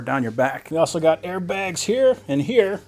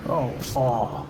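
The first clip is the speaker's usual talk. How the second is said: real groan of relief, low and drawn out; blissful groan; 4 semitones lower, much slower than usual. Real, not acted. Man sinking into massage chair, groaning with pleasure. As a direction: melt into it, lower voice.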